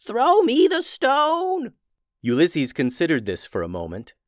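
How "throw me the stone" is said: put-on slow, high, loud old witch demand; old witch yelling. Old witch begging Ulysses for the stone, slow high yell. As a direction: stretch it out as the old witch, urgent. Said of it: "yelled Old Witch Tubes".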